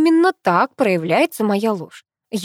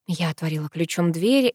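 ordinary delivery

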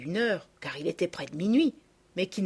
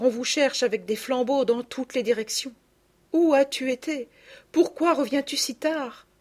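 relieved scolding mother; 6 semitones higher, 4 dB louder than usual. Acted.

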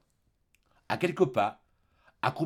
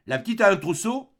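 loud, high question; man calling out; 9 semitones higher, 6 dB louder than usual. Acted. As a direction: bark the question from inside. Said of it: "La voix d'homme reprit". (Thénardier lance fort la question)

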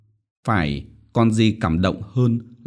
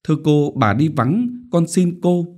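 higher, timid begging voice; fearful pleading; 7 semitones higher than usual. A narrator's reading. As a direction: plead nervously, afraid of her. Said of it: "Anh xe sợ hãi nói". (sợ hãi van xin)

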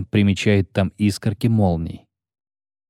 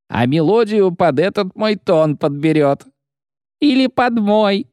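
rowdy guardswoman; 9 semitones higher than usual. Acted. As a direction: jeer at him like a rude soldier. Guardswomen jeer.

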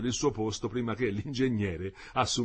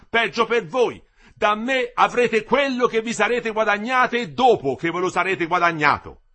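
loud, higher angry reproach; indignant tirade; 9 semitones higher, 9 dB louder than usual. A narrator's reading. The engineer keeps berating the workers indignantly. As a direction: scold them for ingratitude.

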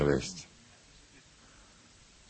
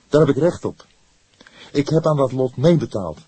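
loud defiant insistence, high pitched; fierce, rebellious; 9 semitones higher, 9 dB louder than usual. A narrator's reading. Young man insists on his share of the prize, fierce and loud. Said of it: "reageerde hij plotseling fel, opstandig".

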